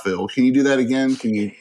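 ordinary talk

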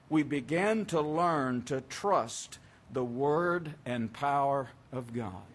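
deliberate, measured read-aloud with drawn-out key words; slow reading. Real, not acted. Preacher reading John Newton's words on assurance to listeners, slowly. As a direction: read it slowly, letting each phrase land. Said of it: "I'll read this slowly".

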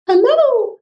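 imitating a girl's tiny, very high hello. Retelling the trafficked girl's greeting in a very high voice. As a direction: pitch it way up like a young girl. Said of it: "spoke to me in a very high voice".

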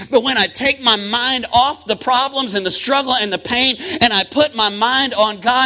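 loud, rising preacher cadence over music; full-force preaching. Acted. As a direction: preach it out with fire.

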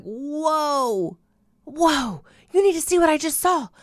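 high, loud, fast rush of awe; excited. Retold or imitated. Telling her friend in an excited rush about the vision. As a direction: burst out with amazement.

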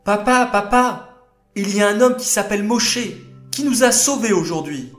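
put-on high, fast, eager little girls' voices; breathless kids blurting out news; excited girls. Daughters rushing to tell their father Yitro, high and excited.

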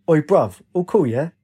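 usual speaking voice

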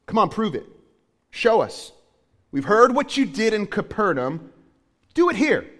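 jeering crowd daring the prophet; taunting. Acted. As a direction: dare him to prove it. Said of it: "They were taunting him".

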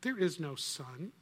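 lightly voiced, slow and grave. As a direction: pronounce it slowly, gravely. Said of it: "Slowly and gravely".

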